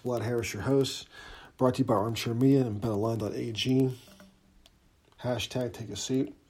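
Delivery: ordinary talk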